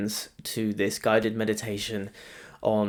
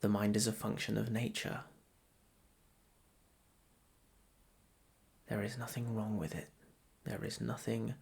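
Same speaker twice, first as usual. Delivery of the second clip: softer, measured meditation voice with long pauses; guided meditation; 7 dB quieter than usual. Acted. Meditation guide to listeners settling into breath practice, soft guided meditation. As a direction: guide them gently into noticing the breath.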